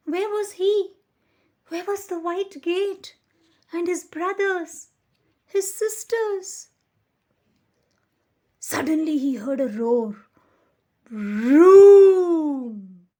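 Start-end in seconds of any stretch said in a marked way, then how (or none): 0.1-6.7 high, anxious, louder questions; pitched-up worried storytelling. A narrator's reading. Lost puppy's worried questions. 11.1-13.1 huge, loud vroom like a passing truck; booming engine noise. A narrator's reading. Truck roars past.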